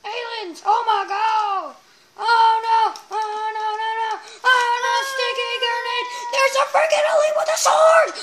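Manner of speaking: high, loud alarm building to a yell; panicked shouting. Real, not acted. Player warning friend of aliens, building panicked shouts.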